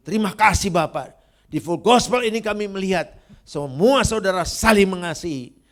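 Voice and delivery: booming, impassioned church prayer; fervent loud prayer. Acted. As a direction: pray over the congregation at full force, proclaiming each line. Leads congregation in closing prayer.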